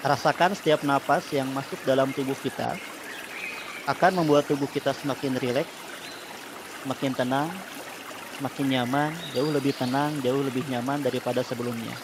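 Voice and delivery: calm guided breathing voice; soothing spa whisper. Acted. Leads relaxation therapy.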